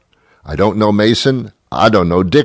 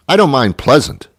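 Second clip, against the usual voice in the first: usual speaking voice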